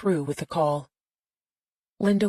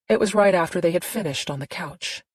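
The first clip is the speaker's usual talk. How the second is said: plain reading voice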